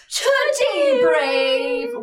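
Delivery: dramatic announcement. Acted. Opening their podcast debrief: slow, rising host announcement. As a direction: stretch it out like a show host. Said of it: "show intro, dramatic announcement".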